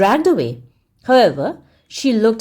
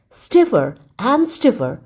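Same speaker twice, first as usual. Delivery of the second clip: plain reading voice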